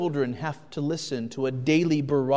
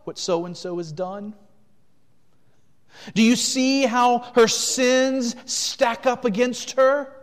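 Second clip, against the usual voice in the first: loud, much higher, prosecuting; accusing devil; 8 semitones higher, 8 dB louder than usual. Acted. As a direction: hammer each charge like an accuser before a judge. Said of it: "the devil ... says to God".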